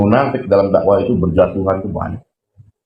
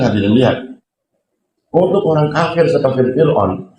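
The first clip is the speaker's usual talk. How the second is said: ordinary delivery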